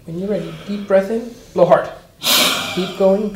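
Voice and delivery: fast and loud, urging hard; blowing out hard. Shown as a demonstration. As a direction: drive the patient to blow hard. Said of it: "coaching a forced blow".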